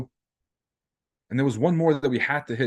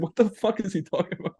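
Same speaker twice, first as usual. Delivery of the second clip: high baffled voice; mock confused crowd; 7 semitones higher than usual. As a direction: sound like everyone scratching their heads. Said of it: "Everyone's like".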